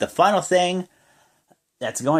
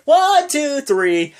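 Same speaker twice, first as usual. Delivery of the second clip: belting out the three count, much higher; excited pin count; 6 dB louder, much slower than usual. Retold or imitated. Recapping the winning pin for viewers, excited shout. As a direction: count it high and loud like the crowd.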